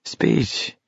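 softly imitated crowd chant; hushed mock yell. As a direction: call out like a crowd, but keep it down. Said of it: "everyone started yelling".

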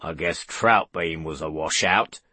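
low menacing growl. A narrator's reading.

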